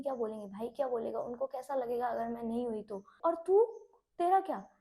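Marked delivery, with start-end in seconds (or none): 3.2-4.7 louder, higher, confronting herself; a real frustrated outburst at herself; sudden loud self-reproach. Real, not acted. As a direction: snap at yourself, demanding an answer.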